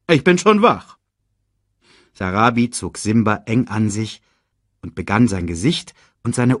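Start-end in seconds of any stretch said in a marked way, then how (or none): 0.1-1.0 high, loud repeated assurance; louder insistence. A narrator's reading. Jolted father assures his wife, high loud insistence. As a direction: insist higher and louder that you are up.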